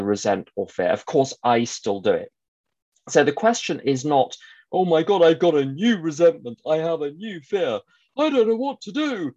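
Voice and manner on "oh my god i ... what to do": high, hammed-up panicky wail; overacted fretting, rising to a squeal; mock panic. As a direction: pretend to be helplessly alarmed, pitch climbing. Mocking a panicked person's reaction.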